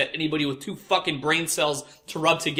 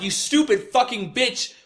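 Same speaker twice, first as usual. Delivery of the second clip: imitated furious rant; 5 semitones higher than usual. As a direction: vent the silent rage at the customer. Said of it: "And I'm like".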